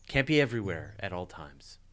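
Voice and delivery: ordinary delivery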